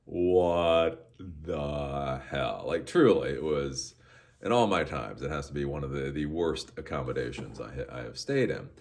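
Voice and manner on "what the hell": drawn-out slow What the hell; disbelief. Retold or imitated. Backpackers staring at the hostel, slow disbelief. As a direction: stretch it in stunned disbelief. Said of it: "all looking at this hostel going".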